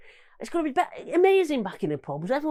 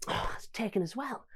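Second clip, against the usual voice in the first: a soft deflated aside; hushed, as if to himself; whispered disappointment; 9 dB quieter than usual. Acted. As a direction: whisper it, let down.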